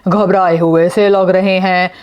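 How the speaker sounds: ordinary delivery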